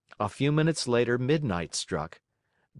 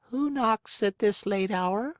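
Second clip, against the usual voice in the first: high, far-off little snail voice; the snail calls down from the window; snail calling out; 11 semitones higher than usual. Acted. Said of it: "she called".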